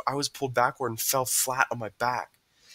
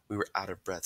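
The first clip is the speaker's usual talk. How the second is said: plain reading voice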